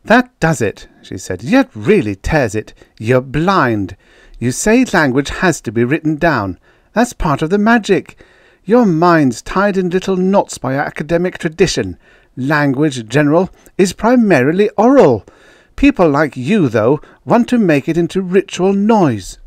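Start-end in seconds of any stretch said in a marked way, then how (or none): 0.0-0.9 high, loud outburst; raised voice, temper breaking; snapped. A narrator's reading. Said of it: "I'm sorry I snapped". 1.4-19.4 high, heated tirade; rising, indignant lecture; snapped. A narrator's reading. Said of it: "I'm sorry I snapped".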